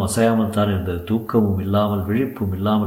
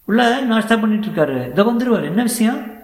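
put-on much higher, boyish voice; boy at the outpost; 12 semitones higher, 3 dB louder than usual. A narrator's reading. Boy tells visitor the policeman is eating, high boyish voice. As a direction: answer high, like a young lad. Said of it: "ஒரு பையன் மட்டும் உட்கார்ந்திருந்தான்".

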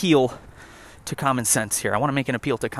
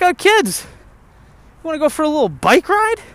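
put-on much higher, slow sing-song dad voice, luring the kids along; hoge, trage, zangerige nep-vadersstem; mock cheery dad; 12 semitones higher, 4 dB louder, slower than usual. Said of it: "He's like".

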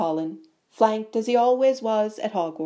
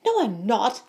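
higher-pitched, indignant denial; angry; 4 semitones higher than usual. A narrator's reading. Boy denies bully's announcement to crowd, mildly angry. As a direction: deny it with a touch of anger. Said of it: "said Harry angrily".

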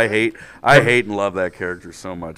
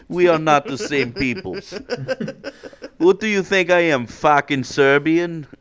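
put-on Slovenian accent; 6 semitones higher than usual. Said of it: "you get to do a Slovenian accent".

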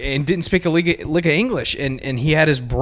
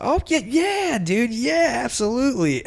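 much higher, overeager yes; eager agreement; 10 semitones higher than usual. Retold or imitated. Young clerk gushing to a customer he can't understand, much higher. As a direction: gush agreement way up high.

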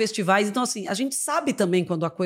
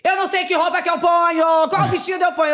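high, loud fussy fretting; imitando a Narcisa; 8 semitones higher, 4 dB louder than usual. Retold or imitated. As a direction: mimic her anxious fussing over clothes. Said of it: "ela falava assim pra mim". (voz aguda e aflita imitando a Narcisa)

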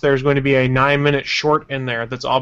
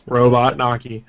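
robot voice; 2 semitones lower, slower than usual. Pretend. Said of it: "angry robot voice".

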